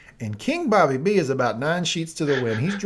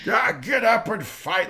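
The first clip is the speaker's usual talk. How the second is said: mock king shout; 8 semitones higher, 4 dB louder, slower than usual. Voicing the king announcing.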